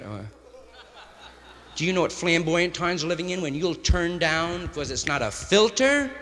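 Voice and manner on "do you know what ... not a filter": mock outrage. Mocking a spoiled smoker.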